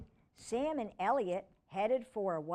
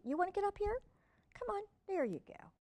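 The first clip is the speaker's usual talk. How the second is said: coaxing puppy; 12 semitones higher, much faster than usual. Real, not acted. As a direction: coax him up, pitched up. Coaxing puppy up beside her.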